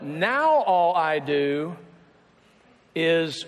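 long, slow swoop up on "now"; mock-dreary, dragged-out refrain; drawn-out lament. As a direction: stretch every word, sliding down after "now". Weary married refrain, drawn out.

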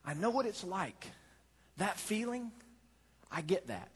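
Jesus comforting the listener; gentle. Acted. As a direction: say it softly and kindly. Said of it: "Jesus said".

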